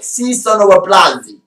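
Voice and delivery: "Si sono applausi" in a hammed-up Italian accent, sung out theatrically. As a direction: play an exaggerated Italian showman on "Si sono applausi". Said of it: "I sometimes I do an Italian accent".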